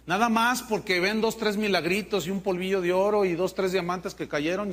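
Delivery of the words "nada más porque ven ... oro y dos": usual speaking voice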